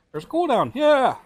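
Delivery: high, loud disbelieving yell; exasperated outburst. Real, not acted. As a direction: shout it in disbelief. Exasperated at game cooldown.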